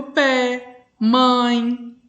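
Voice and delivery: "pé" and "mãe" each in one unbroken beat; said in one syllable. Shown as a demonstration. Teacher shows pupils one-syllable words, single drawn beat each. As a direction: say "pé", pause, then "mãe", each as a single sound. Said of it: "A gente fala de uma só vez".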